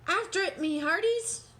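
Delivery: commanding shout. Acted. Captain commanding the crew after the ball. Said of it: "Braid Beer commanded".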